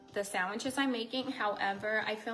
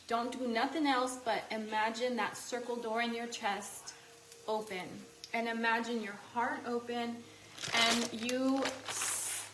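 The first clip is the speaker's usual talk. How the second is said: ordinary talk, nothing marked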